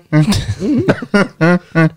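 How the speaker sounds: high honking impression laugh; Eddie Murphy. Shown as a demonstration. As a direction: honk out a comedian's signature laugh in bursts. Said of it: "I used to be able to Eddie Murphy laugh".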